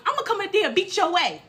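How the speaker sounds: hurried, high-pitched fighting words; mock angry threat. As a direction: imitate an irate caller cutting in. Caller's threat acted out.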